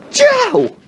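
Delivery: loud, very high sneeze shout; mock sneeze. Sir Isaac sneezing over the cakes, loud mock sneeze. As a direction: burst out a fake sneeze. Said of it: "then Sir Isaac just goes".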